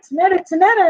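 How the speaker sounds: high, loud call of a mother's scolding; mama calling her name. Retold or imitated. Mom calling her daughter by name, high and loud. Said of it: "My mama could come out and be like".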